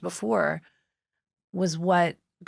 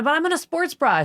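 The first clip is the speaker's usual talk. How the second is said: protesting; 10 semitones higher, 5 dB louder than usual. Retold or imitated. Protesting to friend.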